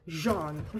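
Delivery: Jean said like a French reader; French pronunciation. Shown as a demonstration. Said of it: "Did you like the way I say Jean?".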